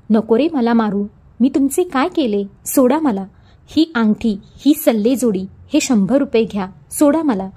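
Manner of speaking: ordinary delivery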